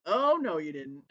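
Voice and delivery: sassy disbelief. Retold or imitated. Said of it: "I was like".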